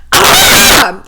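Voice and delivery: short, very high mock scream; imitated shriek of frustration; exasperated scream. Paris driven mad by his picking, imitated high scream.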